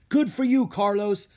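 loud, high praise; cheering. Acted. Diego cheers Carlos for connecting, loud and high. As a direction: cheer him on proudly. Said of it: "Diego cheered".

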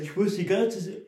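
high voice swallowed by a yawn; real yawning. Real, not acted. Co-host interrupts plant talk with complaint, yawning. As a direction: start talking and let a yawn take over. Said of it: "ich muss die ganze Zeit gähnen".

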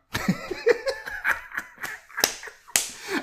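high, loud burst of real laughter. Real, not acted. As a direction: crack up out loud at the question. Host's question about crying.

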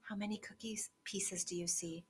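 hushed, breathy counting whisper. Acted. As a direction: whisper it like a secret. Counting the cookie pieces secretly.

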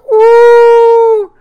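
high, loud cheering woo. Real, not acted. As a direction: whoop with joy. Cheering John C. Reilly's homecoming.

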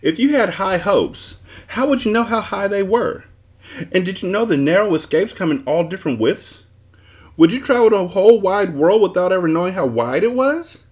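high-pitched, overwrought tirade; furious shouting. A narrator's reading. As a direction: rant on without letting anyone interrupt. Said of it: "shouting furiously".

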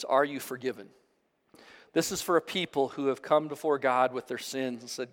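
usual speaking voice

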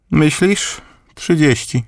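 put-on high old grandmother's voice; grandma voice. A narrator's reading. Grandmother bids and questions her grandson, voiced high. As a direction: bid sharply, then ask curiously.

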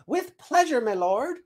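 very high, servile squirrel chirp; obliging squirrel messenger. Acted. Squirrel accepts the seed's errand, obliging chirp.

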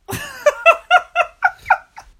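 loud high burst of laughter; big laugh. Real, not acted. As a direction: crack up loudly. Laughing at the silly story.

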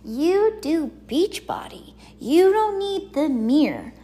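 playful imitation of a bossy husband; imitating husband. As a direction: quote the husband in a lifted put-on voice. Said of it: "then my husband's like".

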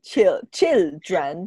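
put-on "children" slowly split as "chil" and "dren"; sounded-out word. Shown as a demonstration. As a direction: draw out "children" syllable by syllable. Sounding out after spelling it.

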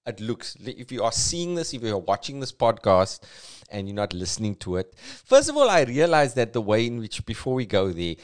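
ordinary delivery

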